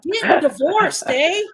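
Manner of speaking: a wry Canadian eh from the uncle; imitating a Canadian uncle. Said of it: "my Canadian uncle is always funny".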